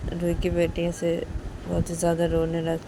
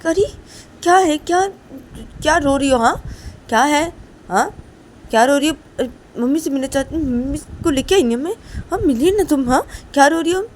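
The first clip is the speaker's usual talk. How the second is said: louder, higher scolding with a hint of comfort; loud scolding mother; 8 semitones higher, 8 dB louder than usual. Mother loudly scolding her weeping child to stop crying. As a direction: shout down the crying, then coax.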